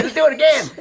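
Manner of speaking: high, loud New Zealand lilt on "Let's do it again"; Kiwi accent. Retold or imitated. Director again asking for another take, in a Kiwi accent. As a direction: keep the Kiwi director impression going. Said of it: "in his Kiwi accent".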